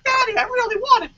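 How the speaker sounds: imitating a pleading little daughter; little girl voice. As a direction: beg like a small daughter. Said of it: "But she's like".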